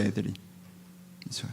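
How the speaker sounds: normal talking voice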